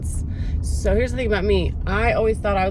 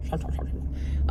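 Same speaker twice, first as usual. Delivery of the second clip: raspy voiceless growl, much softer; the Grinch; 5 dB quieter than usual. Pretend. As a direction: rasp it out under the breath like a grouchy creature. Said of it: "that's my Grinch impression".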